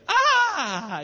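a sudden high cry of amazement; surprised cry. Retold or imitated. Surprised cry as the businessman names the huge sum. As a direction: gasp it out, high and loud.

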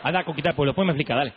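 waving off the excuses, lightly voiced; Dios impaciente. Dios corta a Moisés. (Dios cortando las excusas de Moisés, tono más grave y despreocupado)

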